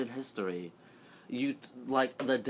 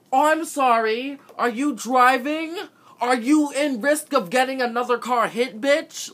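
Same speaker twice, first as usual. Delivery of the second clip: sarcastic furious outburst; 10 semitones higher, 7 dB louder than usual. Retold or imitated. Snapping at the examiner over the speed, high and loud. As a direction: let her have it, sneering and loud. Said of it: "I'm like".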